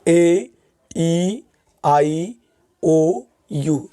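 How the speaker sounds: drawn-out letter names, slowly; teacher reciting vowels slowly; slow vowel listing. Shown as a demonstration. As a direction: spell the vowels out slowly for the class. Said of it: "अब vowels कौन कौन से होते हैं?".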